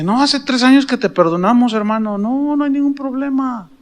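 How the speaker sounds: más agudo y suave, quitándole importancia; perdón tranquilizador. Retold or imitated. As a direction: tranquiliza al hermano con voz alta y blanda. Tranquiliza al pastor perdonándolo. (tono conciliador, agudo y suave)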